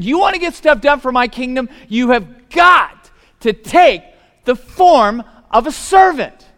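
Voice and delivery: loud, high, slowed shouting sermon; full-force preaching. Acted. Preacher hammering the servant lesson to the congregation, shouting. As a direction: drive it home at the top of the voice.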